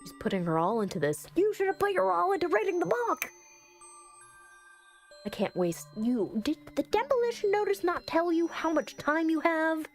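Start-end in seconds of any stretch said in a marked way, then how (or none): 1.4-3.3 high, indignant outburst; exasperated scolding. Real, not acted. Player telling character to read the book, exasperated scolding. 6.4-9.9 high, incredulous retort; exasperated argument. Real, not acted. Player challenging character over the demolition notice, exasperated.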